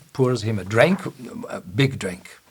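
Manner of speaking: ordinary talk, nothing marked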